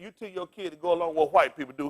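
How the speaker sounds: ordinary talk, nothing marked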